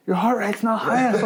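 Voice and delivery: high-pitched nagging coach impression; mimicking the coach. Coach watching the heart monitor, mimicked high demand. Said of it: "He's like".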